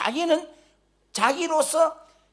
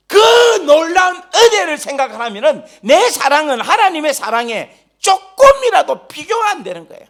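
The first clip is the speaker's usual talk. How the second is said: ordinary talk, nothing marked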